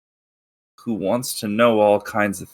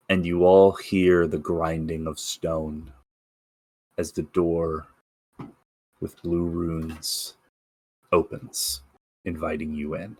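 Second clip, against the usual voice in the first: dramatic narration; 6 semitones lower, 4 dB quieter than usual. A narrator's reading. GM narrates the god's arrival.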